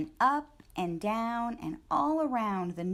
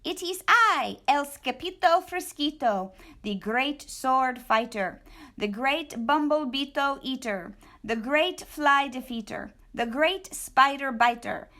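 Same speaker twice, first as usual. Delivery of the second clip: high, loud grand self-introduction; boastful hero; 4 semitones higher, 5 dB louder than usual. A narrator's reading. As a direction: announce himself like a great swordsman. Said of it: "said Skipito".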